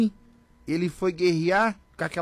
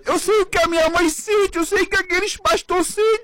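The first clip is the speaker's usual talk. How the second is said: oração emocionada; 12 semitones higher, 4 dB louder than usual. Retold or imitated.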